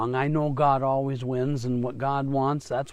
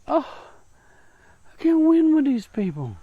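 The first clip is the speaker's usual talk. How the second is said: high, louder groan of defeat from God; exasperated God; 10 semitones higher, 5 dB louder than usual. Retold or imitated. God looking at his people, high and loud in exasperation. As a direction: sigh as if beaten by his own people. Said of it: "God looks at us and just goes".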